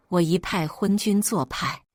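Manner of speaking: ordinary delivery, nothing marked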